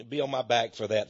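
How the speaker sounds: usual speaking voice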